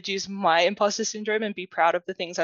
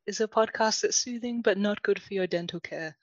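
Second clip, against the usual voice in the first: mock podcast intro; 2 semitones higher, 3 dB quieter than usual. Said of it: "This is Laura's meditation podcast".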